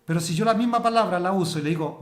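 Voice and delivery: ordinary talk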